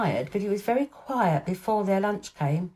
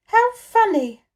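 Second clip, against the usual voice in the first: put-on high, louder, thrilled little girl; delighted girl; 13 semitones higher, 8 dB louder, slower than usual. A narrator's reading. Mary delighted by Colin's idea, high and excited. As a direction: burst out in delight.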